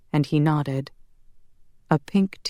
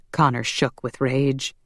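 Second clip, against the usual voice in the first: plain reading voice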